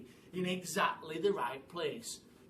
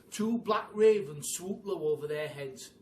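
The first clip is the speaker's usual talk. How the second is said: usual speaking voice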